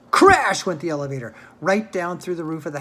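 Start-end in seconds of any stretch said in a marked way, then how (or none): none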